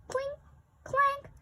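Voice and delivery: high clinking voice; metal clank sounds. A narrator's reading. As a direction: ring it out like metal.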